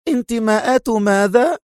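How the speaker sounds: high-pitched incredulous question; exclaiming. A narrator's reading. Sonia scoffs at Adham's accusation, incredulous cry. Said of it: "هتفت".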